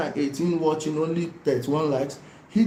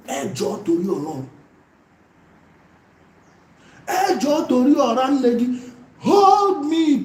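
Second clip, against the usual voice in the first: mock begging; 5 semitones higher than usual. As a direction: beg theatrically to taunt him. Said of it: "I am begging you".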